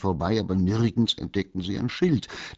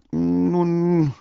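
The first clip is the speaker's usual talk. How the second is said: high, loud, drawn-out pronouncement; lofty, generous announcement; magnanimous; 13 semitones higher, 9 dB louder than usual. A narrator's reading. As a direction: stretch it grandly, as if granting a favour. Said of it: "erklärte das Eichhörnchen großmütig".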